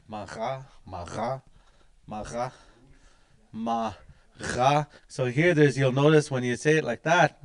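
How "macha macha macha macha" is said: "macha" repeated slowly with a rising end; practice drill. Shown as a demonstration. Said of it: "It's good to practice that".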